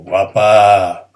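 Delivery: loud, admiring catcall; teacher demoing a piropo; flirtatious compliment. Shown as a demonstration. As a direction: call out a compliment.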